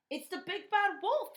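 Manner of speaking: high frightened cry; Percy crying out at the sight of the wolf. A narrator's reading. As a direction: cry out in alarm. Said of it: "he cried".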